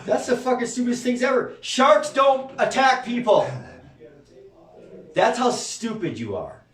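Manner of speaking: high, loud exasperation; scornful outburst. Real, not acted. Scolding believers over their shark fear, exasperated and loud. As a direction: raise pitch and volume, stretching the final words.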